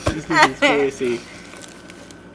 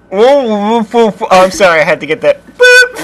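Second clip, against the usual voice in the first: louder, shrieking pterodactyl cry; 5 semitones higher, 15 dB louder, slower than usual. Pretend. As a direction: screech it out like a flying reptile. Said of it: "This is how you do a pterodactyl impression".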